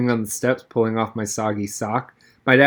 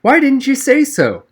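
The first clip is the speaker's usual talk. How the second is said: high, louder sympathetic surprise; sudden understanding, pitched way up; softened surprise; 13 semitones higher, 5 dB louder than usual. A narrator's reading. Teacher softens toward the boy, high sympathetic surprise. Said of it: "expression softened immediately".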